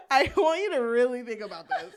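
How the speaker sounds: loud, laughing plea; real cackling amusement. Real, not acted. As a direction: push the words out through a big laugh. Laughing while making her point.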